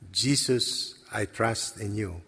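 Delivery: hushed, fading repetition of the prayer; soft prayer. Retold or imitated. Man repeats after God's voice, hushed and fading. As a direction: let it sink to almost nothing.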